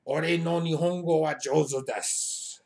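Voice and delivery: emphatic mock swagger in Japanese; boastful reply. Shown as a demonstration. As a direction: announce your skill with full confidence.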